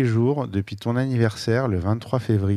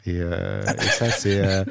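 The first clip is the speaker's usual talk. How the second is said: a warm chuckle slipping into the words; much slower than usual. Real, not acted. Reminiscing about Brazilian friends.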